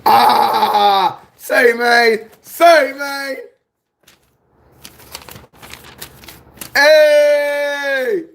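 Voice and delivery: high thrilled calls; excited. Real, not acted. Nephew thrilled by auntie's package, calling out excitedly.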